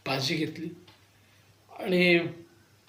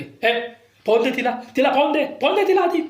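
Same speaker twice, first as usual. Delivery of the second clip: furious boss; 8 semitones higher, 4 dB louder than usual. Retold or imitated. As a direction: pitch climbing, loud furious demand. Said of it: "तेही वैतागले, भडकले".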